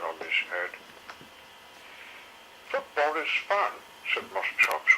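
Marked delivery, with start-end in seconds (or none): 2.7-3.7 put-on higher, eager kid dinosaur; bright little dinosaur making a case; young dinosaur voice. A narrator's reading. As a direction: chirp it like a cheerful youngster. Young Moss Chops voice.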